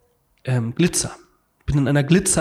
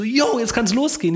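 higher-pitched "Yo" kickoff; retold eager cheer; 8 semitones higher than usual. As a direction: act raring to get going. Retold cheer to start the trip.

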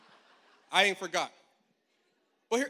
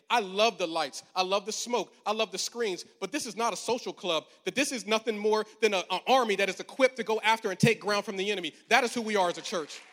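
rising, louder rallying preacher cadence; preaching at full force; 5 dB louder than usual. Acted. A pastor rallying his church as an army, preaching loud. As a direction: drive it home to the congregation.

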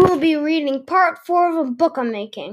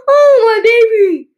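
high, loud, drawn-out wail; hammed-up motherly moan; moaning mom; 8 semitones higher, 8 dB louder than usual. A narrator's reading. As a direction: wail it slowly like a distraught mom. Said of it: "Mom moans".

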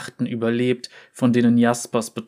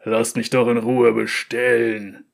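booming rumble. A narrator's reading. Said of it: "Mit einer Stimme so laut ... brummte der Neuankömmling".

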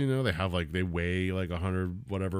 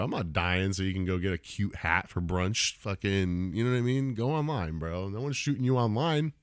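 ordinary delivery

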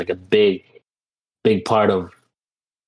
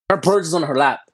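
ordinary delivery

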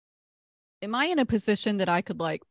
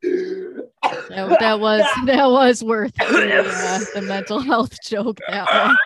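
laughing; 4 semitones higher, 7 dB louder than usual. Real, not acted. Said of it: "laughing after the sound effect".

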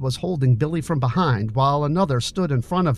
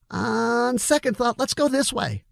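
a sudden nervous change of plan; Billy voiced; 10 semitones higher than usual. A narrator's reading.

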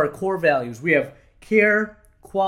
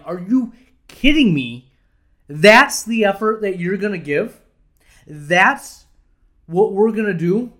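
louder, slower, incredulous replaying of the anger; retold irate outburst; 3 semitones higher, 5 dB louder than usual. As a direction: relive the fury at the half-hearted effort. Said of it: "irate. Out of my mind".

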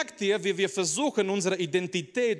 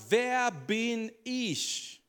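slow, drawn-out, high; doubting Moses; 6 semitones higher, much slower than usual. Acted. Moses asks God who he is, drawn out slow and high. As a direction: stretch each word out in disbelief.

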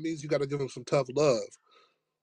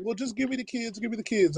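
much higher squeaky mock-frantic voice; mocking Miss Sharon; 6 semitones higher than usual. Miss Sharon babbling excuses to narrator in hallway, squeaky high mock voice. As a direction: lampoon her, pitched way up and flustered. Mocking teacher demanding kids.

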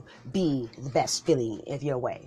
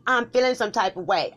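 heated; 6 semitones higher, 5 dB louder than usual. Acted. Couple arguing, comic sketch.

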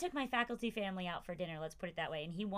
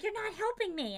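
put-on much higher, louder childish whine; mock whiny daughter; 12 semitones higher, 6 dB louder than usual. Mom imitating her daughter complaining about homework help, higher hammed-up whine. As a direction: whine it like a frustrated twelve-year-old.

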